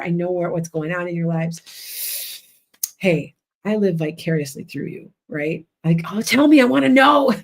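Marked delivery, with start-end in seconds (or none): 6.1-7.3 mock eager plea. Voicing her eager curiosity.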